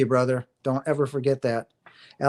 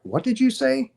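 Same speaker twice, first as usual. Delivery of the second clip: high-pitched, acted-out astonishment; startled question; 10 semitones higher than usual. Speaker replaying reply to Uber driver, startled question. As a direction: jump up in pitch, as if taken aback.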